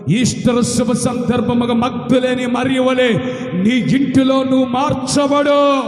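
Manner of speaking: loud, slow, proclaiming blessing; full-force preaching. Acted. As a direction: declare it over the crowd. (బిగ్గరగా ఆశీర్వాద ప్రకటన)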